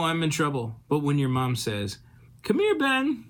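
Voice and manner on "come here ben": high, sweet mom's call; loving mom calling. Retold or imitated. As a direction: invite him in fondly.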